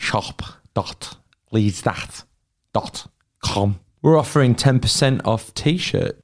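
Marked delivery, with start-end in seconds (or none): 0.0-3.8 put-on a stab at a Scouse accent, slow and soft. Cohost asked to say the web address in Scouse, mock Scouse accent. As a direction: put on a Liverpool accent, dragging the words. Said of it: "Can you say that in a Scouse accent?".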